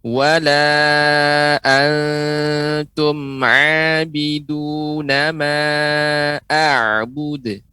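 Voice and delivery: slow model chant, open throaty "a" in "abiduna"; measured Quran recitation with open-vowel ain in "abiduna"; ain with fathah. Shown as a demonstration. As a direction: draw out "Wala antum", clear ain on "abiduna" and "a'bud". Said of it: "tetapi pengucapan a, fathah".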